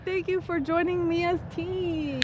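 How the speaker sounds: high, drawn-out singsong thank-you; high-pitched, lilting thanks; singsong gratitude. Real, not acted. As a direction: sweetly sing out the thanks, stretching the words. Baby-talk thanks to a supporter.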